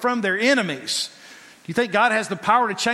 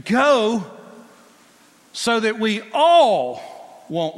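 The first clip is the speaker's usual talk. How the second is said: urging go; 4 semitones higher, 7 dB louder, slower than usual. Acted. Egyptians urging Israel to leave.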